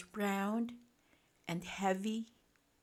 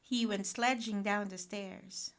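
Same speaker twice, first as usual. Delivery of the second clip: ordinary delivery, nothing marked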